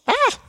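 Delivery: high mock scream. The king hearing the curses, a hammed-up high shriek. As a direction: yelp like the shocked king.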